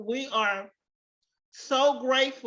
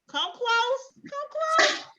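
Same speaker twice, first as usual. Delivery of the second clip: playful calling. Real, not acted. Teacher beckoning students to the screen, high playful coaxing. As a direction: come close in a much higher, fading sing-song.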